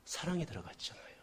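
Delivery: ordinary delivery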